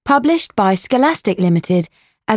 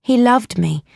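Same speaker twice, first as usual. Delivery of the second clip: plain reading voice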